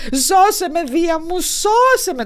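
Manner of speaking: a hint of tearful begging wife; whimpering plea. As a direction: whimper to Zeus for rescue. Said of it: "κλαψουρίζοντας".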